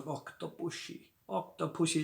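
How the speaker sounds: put-on soft, slurred Indian Connery accent with "pushy" in Octopussy; Indian-tinged Sean Connery accent. Reviewer imitates Kamal Khan saying Octopussy, Indian Connery accent. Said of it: "like an Indian version of Sean Connery".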